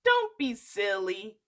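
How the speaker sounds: loud brushing-off; dismissive giantess. Acted. Wife fobbing off the giant, loud. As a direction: wave her husband off. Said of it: "his wife said".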